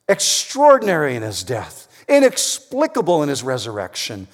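loud, high, preached proclamation; ringing pulpit preaching; preaching at full force. Acted. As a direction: proclaim it to the congregation.

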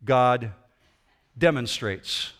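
slow, deliberate "God demonstrates.", pause before "demonstrates."; stress on demonstrates. Shown as a demonstration. Preacher pointing the congregation to "demonstrates.", with a pause. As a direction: lay weight on "demonstrates." with a pause after "God". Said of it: "I want you to notice that word".

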